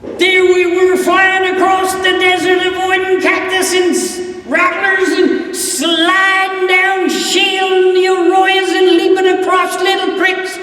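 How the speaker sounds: loud, high, racing through the chase; excited galloping narration. A narrator's reading. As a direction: call it out like riding hard across the desert.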